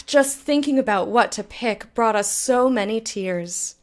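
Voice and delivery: ordinary talk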